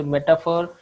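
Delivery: ordinary delivery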